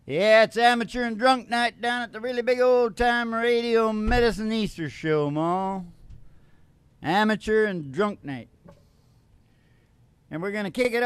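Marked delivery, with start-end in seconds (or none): none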